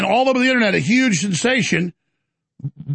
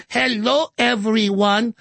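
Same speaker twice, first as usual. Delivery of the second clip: Kamala Harris; 4 semitones higher, much slower than usual. Acted.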